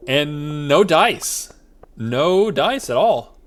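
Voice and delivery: very slow, stretched-out words; drawn-out letdown. Real, not acted. Gamer sighing over a fruitless crab hunt, slow and drawn out. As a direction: drag each word out in defeat. Disappointed at finding no crabs.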